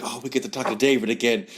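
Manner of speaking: quick, lightly excited quote; imitating excited wife. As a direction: lightly mimic her eagerness, talking fast. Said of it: "She was so excited".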